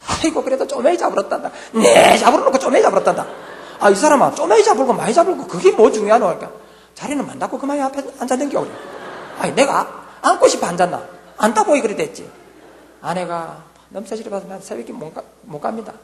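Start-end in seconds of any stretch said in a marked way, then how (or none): 0.1-6.3 higher, much louder exasperated back-and-forth; husband and wife bickering loudly on the way home; heated marital spat. Retold or imitated. Quarrel with wife after service. 6.9-12.3 bickering wife. Retold or imitated. Quarrel with wife continues.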